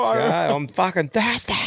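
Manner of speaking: high, slow, hammed-up thirsty bloke; rowdy drinker. Playing a grandad keen to drink, slow and high. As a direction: draw it out like a thirsty drunk.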